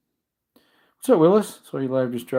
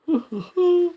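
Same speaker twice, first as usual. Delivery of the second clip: short embarrassed laugh. Real, not acted. Laughs after yawning.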